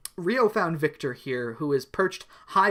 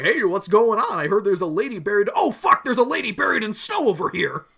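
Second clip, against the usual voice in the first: mountain man voice; 6 semitones higher, 7 dB louder than usual. Acted. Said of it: "He's just like".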